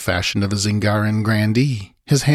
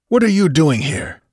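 higher-pitched, abrupt challenge; abrupt demand; 8 semitones higher, 3 dB louder than usual. A narrator's reading. Freebooter challenges his uninvited guest, abruptly. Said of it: "the freebooter demanded abruptly".

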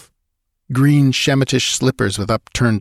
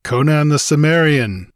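a challenging call, louder; deep challenge; 6 semitones higher, 4 dB louder than usual. A narrator's reading. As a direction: proclaim his name boldly. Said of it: "like the deep challenge of a lion".

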